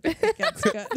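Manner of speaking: squealing high laugh through the words. Real, not acted. Laughing while telling friends the mic should stay on. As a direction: break into a high laugh as it gets good.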